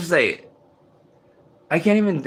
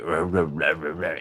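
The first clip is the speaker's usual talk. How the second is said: much lower, softer murmured ruh ruh; low droning mumble; 7 semitones lower, 5 dB quieter than usual. Retold or imitated. Imitating the subliminal noise at night, low droning. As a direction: mimic a muffled chant through a wall. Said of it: "you hear that thing is like".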